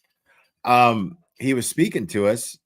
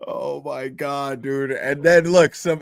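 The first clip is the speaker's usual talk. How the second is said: normal talking voice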